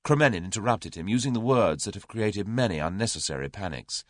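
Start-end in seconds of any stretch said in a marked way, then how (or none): none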